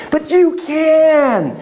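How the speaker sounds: high, slow, drawn-out emphasis; impassioned insistence. Real, not acted. Teacher urging parents that they can voice feelings, impassioned.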